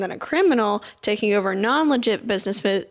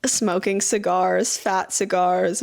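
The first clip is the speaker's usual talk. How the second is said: ordinary delivery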